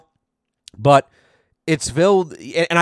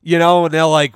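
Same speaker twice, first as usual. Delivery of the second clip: ordinary delivery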